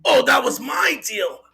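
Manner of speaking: put-on host voice, projected sign-off; Doug impression. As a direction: mimic a show host signing off, stretching the last words. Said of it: "in a Doug voice".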